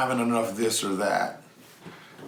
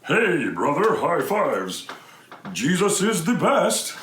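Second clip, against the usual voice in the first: phony hype with a swooping, higher pitch; fake, over-cheery; 5 semitones higher, slower than usual. Greeting a depressed kid insincerely, fake and over-cheery. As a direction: gush it like a plastic youth-group greeter. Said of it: "fake and plastic and phony".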